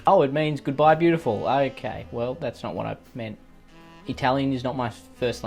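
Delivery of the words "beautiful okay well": normal talking voice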